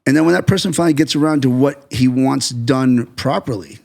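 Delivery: usual speaking voice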